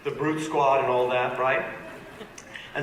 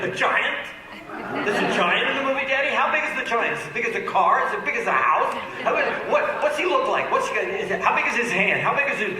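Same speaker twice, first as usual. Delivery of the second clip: put-on high, eager child's voice firing questions; excited little girl; 8 semitones higher, faster than usual. Dad imitating his daughter, high and breathless. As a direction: play the thrilled toddler asking about the giant. Kids quizzing daddy about giant.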